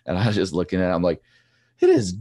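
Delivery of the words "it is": exasperated outburst. Retold or imitated. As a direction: rant it up high and loud, fed up. Frustrated rant about slow track.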